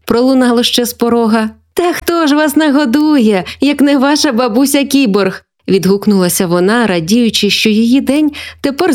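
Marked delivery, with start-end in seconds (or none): 1.7-5.4 lightly voiced, higher-pitched joking granny; cheery grandma. Grandma answering hungry grandchildren, cheerful teasing. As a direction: answer with playful mock indignation.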